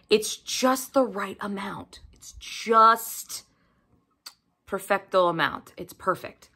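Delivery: soft slow whisper. Real, not acted. Book reviewer whispering her praise to viewers. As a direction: breathe it out like a secret. Savoring perfect suspense amount.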